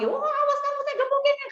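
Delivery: very high-pitched mock rage; hammed-up squealed threat; acted angry threat. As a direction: play someone losing their temper, voice shooting way up. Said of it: "teman-teman lagi marah ya".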